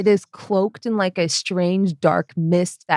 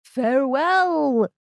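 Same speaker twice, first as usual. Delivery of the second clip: dramatic NPC farewell; 7 semitones higher than usual. Acted. NPC parting line.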